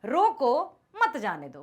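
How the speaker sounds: loud, slow रोको, break, then मत जाने दो; pause after रोको. Shown as a demonstration. Teacher reminding students what is written, loud break after रोको. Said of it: "क्या लिखा है यहाँ".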